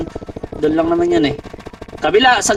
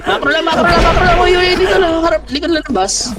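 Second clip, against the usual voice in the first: high, raised team calls; urgent shouting; 6 semitones higher than usual. Real, not acted. Shotcaller directing teammates behind the bus, high urgent shouting. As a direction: bark orders mid-fight.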